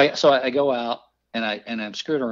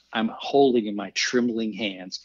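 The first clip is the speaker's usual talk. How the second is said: ordinary delivery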